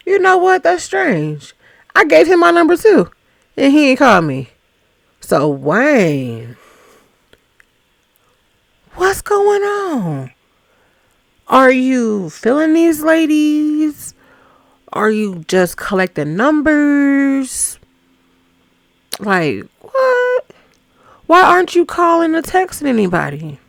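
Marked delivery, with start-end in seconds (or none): 0.1-4.5 higher, a bit louder, catching on; surprised realization. Retold or imitated. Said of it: "she said". 9.0-23.6 exasperated questioning. Real, not acted. Questioning Wayne on screen.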